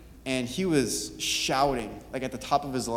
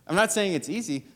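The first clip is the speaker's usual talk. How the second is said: laughing; 5 semitones higher than usual. Real, not acted.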